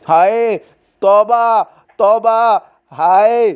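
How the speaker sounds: high, drawn-out lament; exasperated wail. Acted. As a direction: wail in despair at the din. Husband wails at the din.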